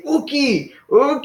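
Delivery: "Uki" thrown high and forceful; emphatic no. Shown as a demonstration. Teacher shows the student how to refuse convincingly, emphatic. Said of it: "you wanna say it really emphatically".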